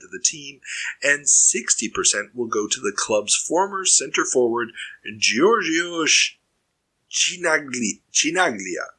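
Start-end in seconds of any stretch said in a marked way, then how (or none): 5.2-8.9 slow, careful Italian accent on Chinaglia. Shown as a demonstration. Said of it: "give it my best Italian accent here".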